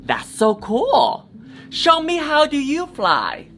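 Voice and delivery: slow, awed then high excited question; amazed. Acted. Little girl amazed that Mulan can fly, excited. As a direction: marvel at it, then ask eagerly. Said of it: "Then a little girl said".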